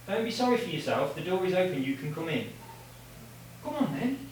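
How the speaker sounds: very soft, high cajoling; coaxing pet talk. Real, not acted. To Filippo at the open door, gentle coaxing.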